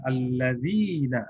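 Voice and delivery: "alladzina" slowly, with a drawn-out "dzii"; long madd. Shown as a demonstration. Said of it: "panjangnya dua harokat".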